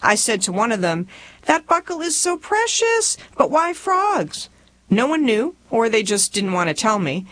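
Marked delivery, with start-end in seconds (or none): 1.5-4.5 high gushing compliment turning baffled; gushing puzzlement. Acted. Freshman to a rich classmate about her belt, high gushing puzzlement. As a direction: gush in a high voice, then draw out the question. Narrator's younger self admires a buckle.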